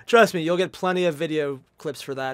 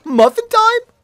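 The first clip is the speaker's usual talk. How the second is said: high loud sing-song call; excited shout; 12 dB louder, slower than usual. Acted. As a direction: belt it like a party catchphrase. Playful shouted catchphrase.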